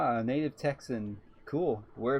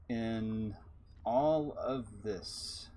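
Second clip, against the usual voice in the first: slow awe; much slower than usual. Acted. Awed cosmic musing.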